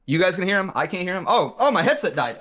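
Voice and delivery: ordinary talk, nothing marked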